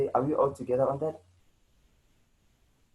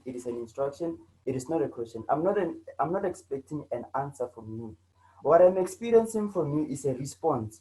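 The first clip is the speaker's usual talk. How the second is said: normal talking voice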